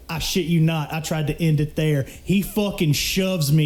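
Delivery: ordinary talk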